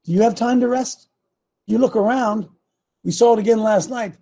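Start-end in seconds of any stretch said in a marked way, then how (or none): none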